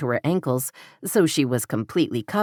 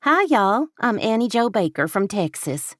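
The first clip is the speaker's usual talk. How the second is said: hammed-up Texas greeting, higher pitched; Texas country accent; 6 semitones higher than usual. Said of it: "falling back into the accent".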